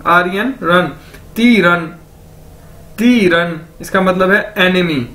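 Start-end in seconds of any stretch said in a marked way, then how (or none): 1.4-3.6 "ती रन" joined, with a long ती; careful language-drill "ती रन"; demonstrated pronunciation. Shown as a demonstration. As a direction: model "ती रन" for learners. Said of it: "इकट्ठा कर दिया जाए".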